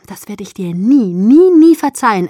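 loud, emphatic protest; heated, exasperated vow; exasperated surrender. A narrator's reading. Narrator reluctantly gives in.

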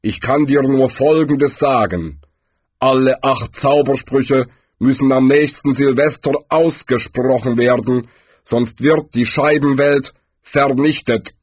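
lightly voiced figure of Death, measured; a touch of grave pronouncement; Death, solemn. A narrator's reading. Death warns the wizard of doom, measured.

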